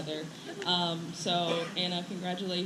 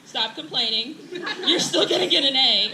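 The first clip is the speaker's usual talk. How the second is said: playful mock scolding, louder and higher, through laughter; mock strict teacher; 4 semitones higher, 6 dB louder than usual. Teacher playfully scolding her students, mock stern and laughing. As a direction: pretend to tell them off, laughing.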